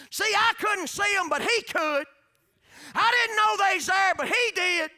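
loud, high revival preaching; shouting gospel preacher; full-force preaching. Real, not acted. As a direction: lift it to the back pew with fire.

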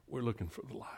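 soft hushed whisper; grieving friend telling his children, whispered. Retold or imitated. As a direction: breathe it out gently. Grieving man quoted, unvoiced.

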